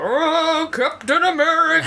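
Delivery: high, put-on booming cosmic voice; grumpy planet god. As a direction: play a giant living planet scolding the heroes. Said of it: "the big planet guy, will come down and go".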